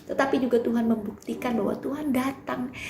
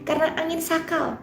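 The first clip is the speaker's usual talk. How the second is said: loud, fervent Bible reading; dramatic scripture reading; 5 dB louder than usual. Acted. Preacher reads the storm passage to the congregation, loud and dramatic. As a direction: proclaim the passage with full force.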